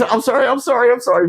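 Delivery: high, flustered repeated apology; startled apology. Retold or imitated. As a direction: imitate someone jumpy and apologetic. Retelling startled man's hasty apology.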